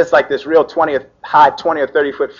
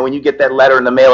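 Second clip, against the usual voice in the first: ordinary talk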